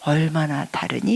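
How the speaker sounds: soft, gentle asking; God's tender question; kind question. Retold or imitated. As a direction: ask it kindly. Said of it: "너무너무 친절하게 말씀하십니다".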